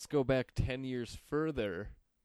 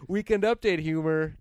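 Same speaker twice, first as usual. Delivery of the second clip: announcer voice; 5 semitones higher, 8 dB louder than usual. Pretend. Mock show announcement.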